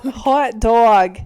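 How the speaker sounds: loud "hot dog" in a Boston accent; Boston accent, falling "dog". Shown as a demonstration.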